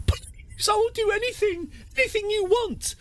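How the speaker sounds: high, pleading prisoner voice; begging prisoner. Acted. Captive leader pleading with the jailer, high and desperate. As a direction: beg for your life in a squeaky high voice.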